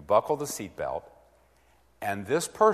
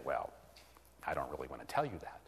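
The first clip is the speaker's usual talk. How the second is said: lower, softer, fast; a low, quick, quiet refusal from the listener; reluctant mumble; 8 semitones lower, 11 dB quieter, faster than usual. Shown as a demonstration. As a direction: mutter it off evasively.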